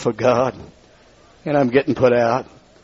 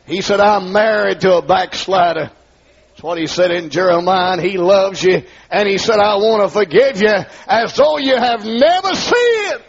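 rising preaching; 5 semitones higher than usual. Real, not acted. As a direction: build the preaching toward a shout. Preaching builds again.

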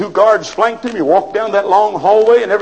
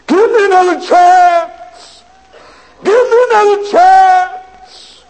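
high, loud, slow anguished cry; wailing from hell; 13 semitones higher, 6 dB louder, slower than usual. Pretend. Preacher voicing the lost crying from hell. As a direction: cry out from the flames. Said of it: "you'll cry".

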